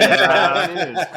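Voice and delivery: laughing through the words; chuckling as you say it. Real, not acted. Laughing reaction to initiative.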